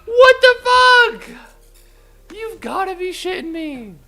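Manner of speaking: very high, loud and slow disbelieving shriek; shocked shriek. Real, not acted. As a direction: shriek as if betrayed by the game. Shocked outburst at game.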